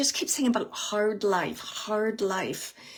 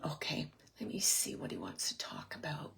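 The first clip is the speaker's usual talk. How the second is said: hushed unvoiced murmur to herself; whispering to herself; 5 semitones lower, 14 dB quieter than usual. Real, not acted. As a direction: drop into a private whisper while you listen. Medium pauses to listen privately.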